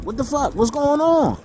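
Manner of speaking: retold confusion when nobody calls back; puzzled disbelief. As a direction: wonder aloud what went wrong. Retelling his confused thoughts.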